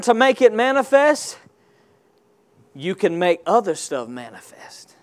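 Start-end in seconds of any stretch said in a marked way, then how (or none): none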